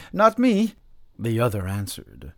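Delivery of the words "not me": high, loud denial; brash peasant bravado. A narrator's reading. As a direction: scoff at being afraid. Villager answers with bravado.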